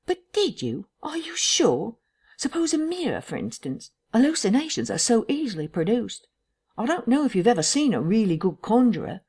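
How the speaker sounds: high-pitched sceptical questioner; doubting Bunting. Acted. Narrator voices doubting Bunting.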